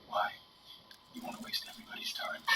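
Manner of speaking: hushed, breathy Clint Eastwood rasp; flat, near-whispered director's put-down; imitating Clint Eastwood. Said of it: "he was like".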